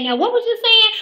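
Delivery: high mocking imitation of the interrupter. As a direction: squeak it out like a clueless interrupter. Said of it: "decide to say".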